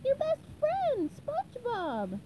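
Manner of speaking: high, slow cry of reunion; high-pitched, pleading introduction; SpongeBob. A narrator's reading. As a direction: draw it out in a very high cartoon voice. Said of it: "SpongeBob cried".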